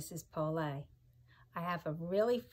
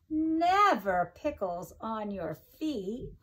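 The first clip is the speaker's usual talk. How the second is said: louder, punchy storybook finale; emphatic read-aloud; 4 semitones higher, 7 dB louder than usual. Acted. Teacher reading the book's big ending to her class, emphatic. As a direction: land the punchline for the kids. Reads rhyming book to children.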